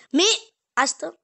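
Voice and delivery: sudden loud order to stop; sharp command. Real, not acted. Owner telling puppy to drop it, sharp loud command. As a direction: raise your voice at the dog.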